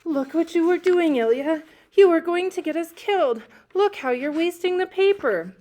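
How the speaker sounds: frantic high scolding shout; scolding yell. A narrator's reading. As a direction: yell at a little brother in a panic. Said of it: "I scolded him in Russian. I yelled".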